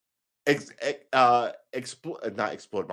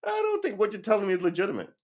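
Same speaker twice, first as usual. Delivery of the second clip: firm skeptical retort; 10 semitones higher, faster than usual. Retold or imitated. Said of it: "to go".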